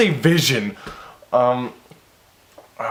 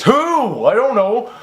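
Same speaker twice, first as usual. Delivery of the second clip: mock bafflement; 9 semitones higher, 4 dB louder, slower than usual. Real, not acted. Confused again by story.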